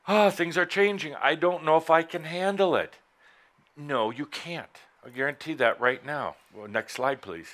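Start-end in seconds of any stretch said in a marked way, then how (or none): 0.1-3.0 hammed-up panic, louder; mock freak-out. Speaker mocking the audience's freak-out, hammed-up panic. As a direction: play the panicking listener, overdone. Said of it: "you do the old freak out thing".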